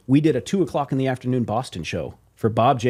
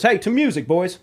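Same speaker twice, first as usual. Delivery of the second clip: higher, hearty put-on toast; boss toasting; 6 semitones higher than usual. Label president toasting the band, higher hearty voice. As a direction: raise a glass with the band. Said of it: "he goes".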